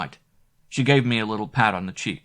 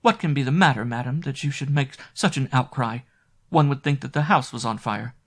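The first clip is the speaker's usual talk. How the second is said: lightly voiced maid; 6 semitones higher, 4 dB louder than usual. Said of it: "her soft voice answering".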